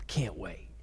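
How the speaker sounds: breathy whisper, almost no voice; whispered reply. Acted. Son whispering to his dad, a soft whisper. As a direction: hush it out under your breath.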